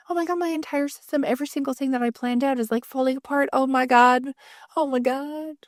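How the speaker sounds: high, panicky replay of being laid off; hammed-up meltdown as the plan collapses; mock panic. Retelling her panic after the layoff, high and frantic.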